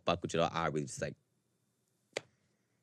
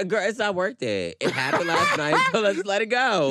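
breezy mock dismissal; 12 semitones higher, 6 dB louder than usual. Acting out her breezy answer.

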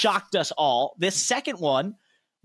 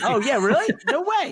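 high, squeaky fake astonishment; host feigns disbelief, much higher, mock amazed; mock surprise; 10 semitones higher, 4 dB louder than usual. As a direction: play up the shock at the reveal. Mock surprise at familiar plot.

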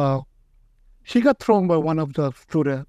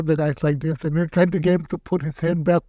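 ordinary talk, nothing marked

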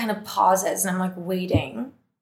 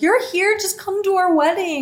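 excited friend; 10 semitones higher than usual. Retold or imitated. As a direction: gush the invite upward. Friend voiced on phone.

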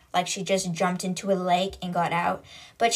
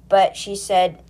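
ordinary talk, nothing marked

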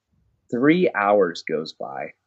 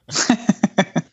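high burst of laughter; real laugh; 10 semitones higher than usual. Real, not acted. Storyteller laughing at own story, high laugh. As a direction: laugh at the memory.

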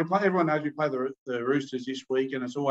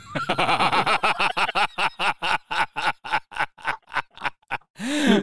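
hearty real laugh; big laugh; 5 semitones higher than usual. Real, not acted. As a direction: laugh out hard at the punchline. Laughs at own joke.

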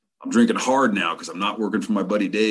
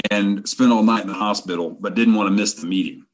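usual speaking voice

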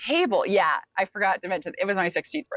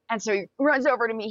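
usual speaking voice

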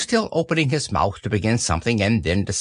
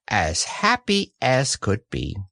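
plain reading voice

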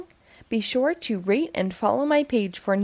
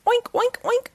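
high squealing oinks; pig oinks; 13 semitones higher than usual. Acted. The pig oinks in alarm, squealing oinks. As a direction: oink like a pig.

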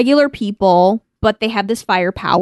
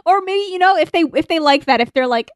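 normal talking voice

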